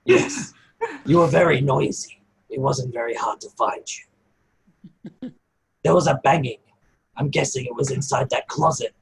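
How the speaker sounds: hint of a wise goblin addressing the party; goblin Cass. DM voicing goblin Cass to the party, lightly voiced, measured.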